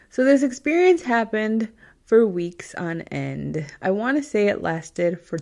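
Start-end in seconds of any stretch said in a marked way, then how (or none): none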